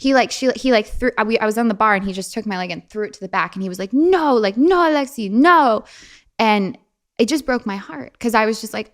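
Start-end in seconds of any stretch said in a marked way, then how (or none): 3.9-5.8 high, loud scolding cries; mock exasperated reprimand, pitched up; imitated teacher's yell. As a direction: imitate a strict teacher yelling at a pupil. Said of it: "yelled at me so hard".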